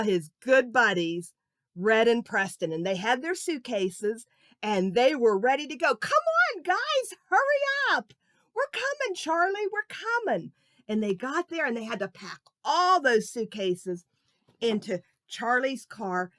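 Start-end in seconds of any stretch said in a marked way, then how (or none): 5.9-10.5 put-on high, eager boys calling out; excited kid voices. Charlie calling his buddies to hurry, high and eager. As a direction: hurry your friends along, bouncing with excitement. Kids calling to each other.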